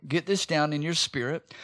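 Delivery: usual speaking voice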